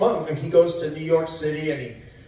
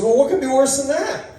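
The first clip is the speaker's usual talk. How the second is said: mock shocked patient; 10 semitones higher, 5 dB louder than usual. Patient reacting to bad news.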